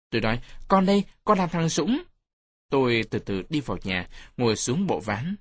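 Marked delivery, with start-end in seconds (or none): 0.7-2.0 put-on high-pitched kid announcing himself; child calling. A narrator's reading. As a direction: call up to an elder as a young boy, higher. Child calls to old man through window. (giọng trẻ con cao, thưa với ông)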